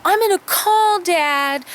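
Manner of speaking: put-on high, slow kid threatening to tell; a little brother threatening to call Dad; whiny threat. Brother threatening Kim by the pool, high and whiny.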